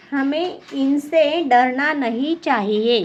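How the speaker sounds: normal talking voice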